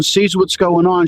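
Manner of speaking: usual speaking voice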